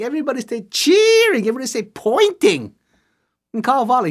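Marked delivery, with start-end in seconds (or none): none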